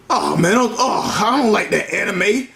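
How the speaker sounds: usual speaking voice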